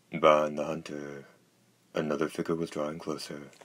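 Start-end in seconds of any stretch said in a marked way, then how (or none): none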